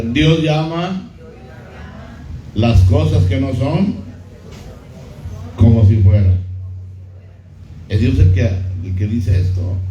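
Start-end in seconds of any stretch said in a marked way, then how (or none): 0.0-6.4 slow teaching chant for the church to repeat; slow call to repeat. Shown as a demonstration. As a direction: spell it out slowly for the congregation to echo. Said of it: "A ver, diga".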